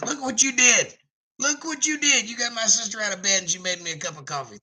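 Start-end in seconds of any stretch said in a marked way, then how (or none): 0.0-3.5 delighted. Real, not acted. Sister brought coffee.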